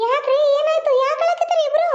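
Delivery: high-pitched worried questions; alarmed questioning. Acted. Grandmother finding the couple in tears, alarmed. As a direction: demand to know what is wrong, pitch climbing.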